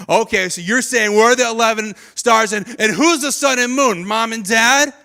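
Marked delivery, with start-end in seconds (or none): none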